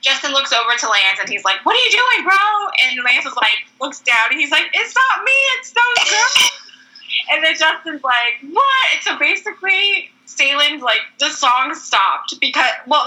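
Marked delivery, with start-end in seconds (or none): 1.6-2.7 high mock-confused "what are you doing, bro"; confused Justin. Justin asking Lance, high and baffled. 4.7-6.5 high squeaky denial; defensive Lance. Retold or imitated. Lance telling Justin, high and defensive. Lance quoted denying, pointing at girls. 8.5-8.9 high startled what; a sudden high squeak of disbelief; shocked what. Retold or imitated. Justin reacting to Lance, high startled.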